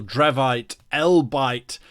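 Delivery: normal talking voice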